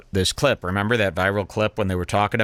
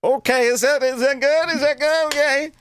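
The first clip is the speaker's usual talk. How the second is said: very high, squeaky put-on voice; mock anxious girlfriend; 13 semitones higher than usual. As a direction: squeal it like an unsure girlfriend in bed. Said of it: "I can't imagine them doing anything else but going".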